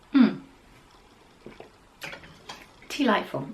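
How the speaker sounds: ordinary talk, nothing marked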